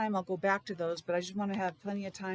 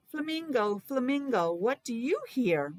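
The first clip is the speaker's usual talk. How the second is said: put-on reading to children; 4 semitones higher than usual. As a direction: read the title like a picture book for little kids. Reads book to class.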